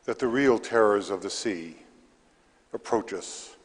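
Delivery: measured verse with pauses between lines; poetry reading. Acted. Poet reads to a library audience, measured poetry reading. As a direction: read the poem aloud, each phrase set apart. Reads own poem aloud.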